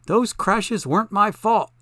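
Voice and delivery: high, loud, slow self-defence; indignant denial. A narrator's reading. Zelda argues with her driver.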